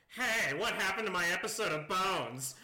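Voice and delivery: nail the cartoon alien impression; Roger the Alien. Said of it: "I have to get the Roger the Alien voice down".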